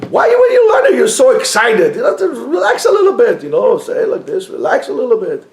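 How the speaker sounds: imitating people urging calm; mock critic. People telling the rebbe to relax, lightly mocked. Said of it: "People come and say".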